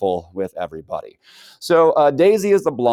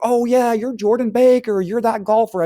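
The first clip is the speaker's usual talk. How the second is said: higher, louder starstruck gush; excited recognition; 12 semitones higher, 4 dB louder than usual. Retold or imitated. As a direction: gush like a fan spotting a celebrity.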